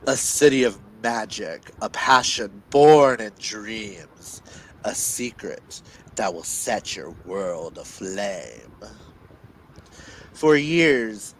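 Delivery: dramatic blurb reading with weighty pauses; dramatic telling. Acted. Reader performing the book synopsis aloud for listeners, dramatic. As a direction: read it like a movie trailer. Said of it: "you can't hear [name]'s dramatic telling".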